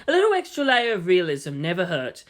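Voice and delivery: ordinary delivery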